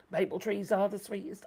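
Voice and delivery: ordinary delivery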